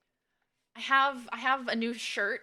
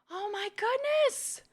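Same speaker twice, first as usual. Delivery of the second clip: concerned gasp; 11 semitones higher, slower than usual. Real, not acted.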